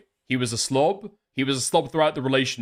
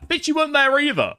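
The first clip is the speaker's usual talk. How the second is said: much higher, scornful comeback; incredulous retort; 12 semitones higher than usual. Real, not acted. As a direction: fire it straight back. Mocking retort at the commentator.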